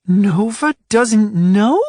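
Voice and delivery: high, slow squeaky question; little squeak. A narrator's reading. Ruby asking in a surprised squeak. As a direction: let the voice crack up into a squeak. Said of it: "her own voice carrying a bit of a squeak".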